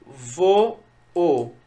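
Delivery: "Vo, o" as two held vowels with a gap; "Vo, o" dictated syllable by syllable; split into syllables. Shown as a demonstration. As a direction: separate "Vo" from the second "o". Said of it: "Eu separo".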